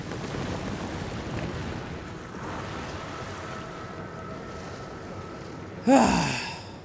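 long weary sigh. Real, not acted. After another setback.

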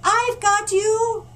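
steady him from below; slow reassuring call. Acted. Panda holding the ladder, calling up slowly and loudly.

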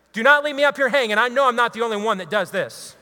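normal talking voice

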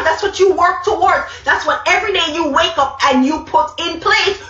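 usual speaking voice

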